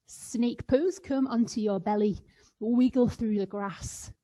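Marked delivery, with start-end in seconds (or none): none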